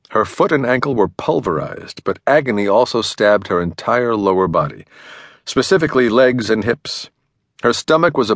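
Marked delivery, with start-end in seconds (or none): none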